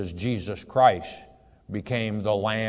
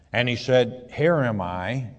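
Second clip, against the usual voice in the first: raised, measured Bible reading voice; scripture reading; 3 semitones higher, 6 dB louder than usual. Acted. Preacher reading Genesis aloud to his flock, solemn reading voice. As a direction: read the verse out to the congregation. Reads verse eleven aloud.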